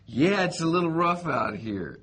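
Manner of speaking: high, loud mock nonchalance; understated calm. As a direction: play it cool, over the top. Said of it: "I was like".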